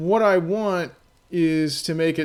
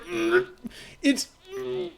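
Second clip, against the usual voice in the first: much higher, choked strained hums; straining to speak; 4 dB quieter, slower than usual. Retold or imitated. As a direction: strain against a word that won't come out. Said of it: "He's like".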